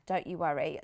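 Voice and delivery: usual speaking voice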